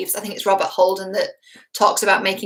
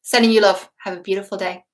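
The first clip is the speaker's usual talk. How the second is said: ordinary talk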